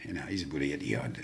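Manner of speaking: normal talking voice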